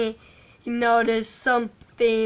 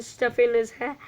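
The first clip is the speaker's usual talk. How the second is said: usual speaking voice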